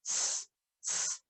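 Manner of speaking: unvoiced s hiss; just hiss an s. Shown as a demonstration. Teacher showing the z sounds like s, a hiss. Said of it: "It sounds like".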